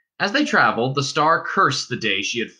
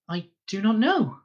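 a hint of a weary young girl, higher; lightly voiced Star; 9 semitones higher than usual. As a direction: ask for help, tired and hurt. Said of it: "said the Star".

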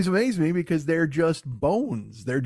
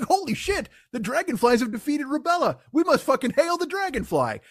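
high-pitched mock awe; hammed-up amazed reverence; awed proclamation; 8 semitones higher than usual. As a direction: proclaim it like a stunned new convert. Awed viewer exalts the dragonfly.

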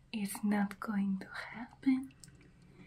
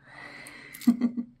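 whispered vampire laugh; 6 dB louder than usual. Acted. Vampire gloating over captive.

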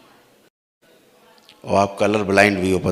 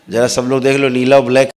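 yelling across the room; 4 semitones higher, 6 dB louder than usual. Real, not acted. Calls out to whole crowd.

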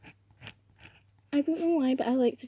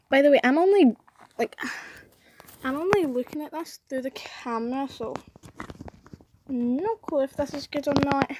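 ordinary talk

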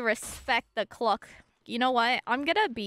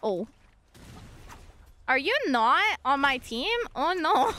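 ordinary talk

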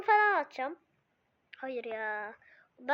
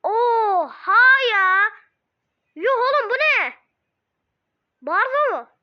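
higher, louder cry of disbelief; shocked gamer, loud disbelief; 6 semitones higher, 10 dB louder than usual. Real, not acted. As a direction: yell in stunned outrage at the screen. Shocked by opponent's card.

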